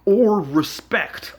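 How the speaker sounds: loud, slow sarcastic jab; exasperated jab. Real, not acted. Jabbing at viewers who lack respect, loud and contemptuous. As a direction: spit it out with contempt. Ranting at people.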